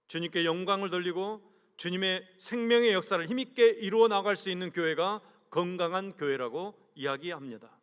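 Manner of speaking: ordinary delivery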